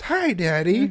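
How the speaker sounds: high little-kid call, mildly imitated; a cheerful small child shouting hello; toddler calling out. Son greeting dad from the top of the stairs, high kid voice.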